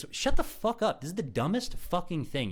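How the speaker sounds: high-pitched, indignant outburst; exasperated outburst. Real, not acted. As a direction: break off in exasperation, pitch jumping up. Breaks off mock speech, exasperated.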